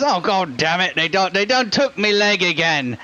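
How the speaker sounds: put-on accent, cursing in dismay; exasperated injured shopkeeper. Shopkeeper finds his limbs missing, exasperated lament. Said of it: "looks down at his leg and goes".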